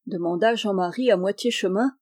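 ordinary delivery, nothing marked

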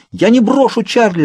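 very high, urgent cry; yelling. A narrator's reading. Girl refusing to abandon her father, yelling. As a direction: shout it out, pitch soaring. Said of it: "заорала я".

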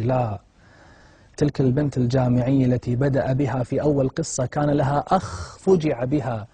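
ordinary delivery

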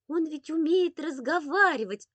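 put-on high, loud, eager child; childlike wide-eyed insistence; insistent little boy. A narrator's reading. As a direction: marvel like a small boy sharing a secret. Boy insists kitten talks.

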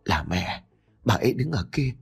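soft, halting, shocked reply; stiff-mouthed fright. A narrator's reading. Son tells his father he saw his dead mother, stunned and soft. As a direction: answer quietly, stunned. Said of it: "mồm miệng cứng đờ trả lời bố".

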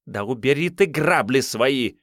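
irritated snap. Acted. Actress snaps at assistant.

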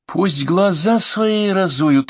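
sharp irritated retort; angry outburst at the dispatcher; flared up. A narrator's reading. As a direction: snap back in irritation. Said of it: "вспылила Офелия".

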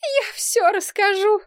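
high, tearful confession; crying, acted. A narrator's reading. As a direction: give in with a theatrical wail. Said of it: "плакала". (плача, высоким голосом)

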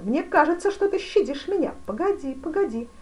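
high, loud, agitated complaint; getting heated. A narrator's reading. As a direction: let the voice climb as temper rises. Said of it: "начиная горячиться". (горячась, на повышенных тонах)

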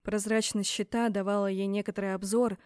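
ordinary delivery

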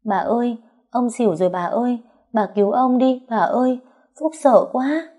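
put-on high-pitched, pouty little child's plea; whimpering child. A narrator's reading. Grandchild begs grandma to save fainted grandpa, hammed-up whimpering. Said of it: "ôm chân dì Vũ mà mếu máo".

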